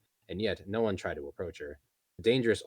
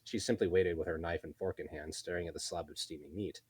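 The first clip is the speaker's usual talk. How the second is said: ordinary delivery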